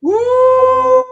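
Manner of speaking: a loud, high whoop of approval; excited cheer. Real, not acted. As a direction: cheer her on, real and loud. Cheering the guest's proud line.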